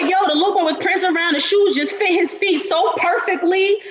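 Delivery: high, fast and animated; excited. Retold or imitated. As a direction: gush about it, quick and bright. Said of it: "She was like".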